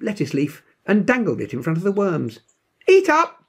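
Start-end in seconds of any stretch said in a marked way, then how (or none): 2.9-3.5 very high, loud bossy order; demanding. A narrator's reading. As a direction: command the worms to eat. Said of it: "demanded Janie".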